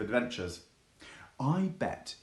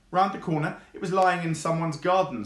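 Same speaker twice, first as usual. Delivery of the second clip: lightly voiced fox; 6 semitones higher, 5 dB louder than usual. Fox explains.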